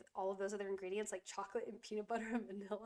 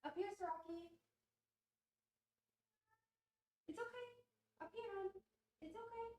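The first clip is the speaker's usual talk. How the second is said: high, soft cooing to the cat; soothing pet talk; 12 semitones higher, 4 dB quieter than usual. Real, not acted. Calling her screaming cat up, high soft cooing. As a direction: coax the cat gently up.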